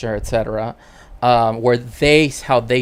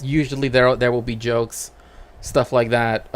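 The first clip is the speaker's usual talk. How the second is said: ordinary talk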